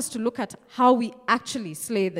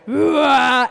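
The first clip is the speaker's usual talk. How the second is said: mock roar; 4 semitones higher, 10 dB louder than usual. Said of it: "He just stands and says".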